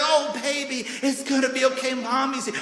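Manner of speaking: put-on tender mother comforting her baby; soothing mom. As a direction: coo to the hurt child. Mom voiced soothing hurt child.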